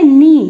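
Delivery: नी said as a clean nee with no k sound; silent k knee. Shown as a demonstration. Teacher to students, answering her own question, loud clear nee. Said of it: "इसको क्या पढ़ना है".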